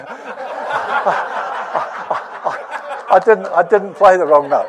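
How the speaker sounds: laughing. Real, not acted. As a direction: chuckle through the denial. Laughs while retelling the joke.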